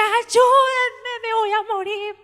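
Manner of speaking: high, loud, sing-song wail; mock wailing scream. The daughter in labour wails to the staff, high and loud. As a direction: wail like she is dying.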